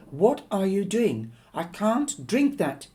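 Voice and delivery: high, loud panicked shriek; panicked daughter shrieking at her mother. Acted. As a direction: shriek it out in horror. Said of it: "she shrieked again and again".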